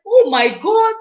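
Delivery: high, loud thrilled "Oh my God"; excited gasp. Retold or imitated. Replaying her reaction to a friend's milestone, thrilled squeal. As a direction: squeal it in happy disbelief. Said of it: "I was like".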